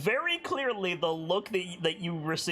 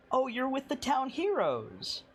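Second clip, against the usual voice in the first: sweet matron; 9 semitones higher than usual. Acted. Matron turns sweet to suitor.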